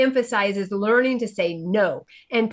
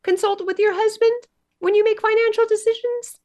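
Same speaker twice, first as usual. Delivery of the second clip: pitched way up in disbelief; incredulous high question; 10 semitones higher than usual. Retold or imitated. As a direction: ask it as if you can't believe it. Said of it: "And I'm like".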